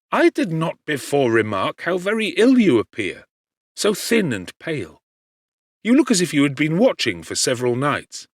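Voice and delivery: a lightly voiced anxious friend; Clerval, concerned. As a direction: point out how ill he looks, with concern. Clerval notices friend looks ill.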